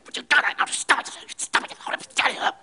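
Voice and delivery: hissed, voiceless stop it, high and tense; ugly face. Retold or imitated. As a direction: hiss the threat face to face at a child. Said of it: "gives him ugly face".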